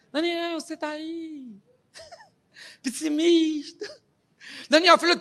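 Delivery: high, slow, thin little weasel voice of the king; the worried king calling down into the pit, whiny. Acted. The king calls Daniel at the lions' den in a whiny weasel voice. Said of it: "com aquela vozinha de fuinha".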